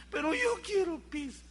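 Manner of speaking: voz aguda de niño que insiste; niño berrinchudo. Retold or imitated. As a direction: lloriquea como niño mimado. (niño caprichoso, voz más aguda)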